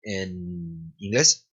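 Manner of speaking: ordinary talk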